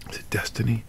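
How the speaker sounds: hushed mysterious whisper. Real, not acted. Whispered musing.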